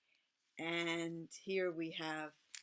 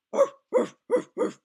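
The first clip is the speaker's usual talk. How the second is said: loud laugh; 16 dB louder than usual. Real, not acted. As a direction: burst of high laughter. Laughs at barking attempt.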